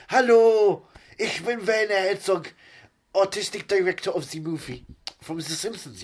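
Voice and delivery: loud, high German cartoon greeting; exaggerated cartoon self-introduction, drawn out; animated Werner Herzog. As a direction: call it out high with a heavy German accent. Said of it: "There is animation of me going".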